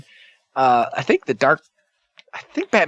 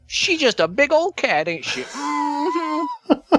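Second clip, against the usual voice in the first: high silly voice; much slower than usual. Pretend. Jokey voiced cat remark.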